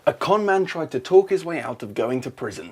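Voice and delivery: ordinary delivery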